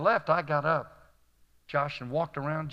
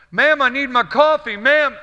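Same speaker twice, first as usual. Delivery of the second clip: high, loud yell imitating rude diners; impatient yelling; 10 semitones higher, 12 dB louder than usual. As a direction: yell for coffee across a busy restaurant. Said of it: "they're just yelling".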